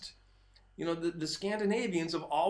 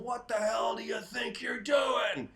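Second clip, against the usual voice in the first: retold yell; 6 semitones higher than usual. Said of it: "he yells down".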